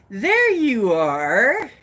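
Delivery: loud, slow, swooping call. Pretend. Host greets arriving viewers, calling out slowly. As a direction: stretch it out with a big pitch swoop.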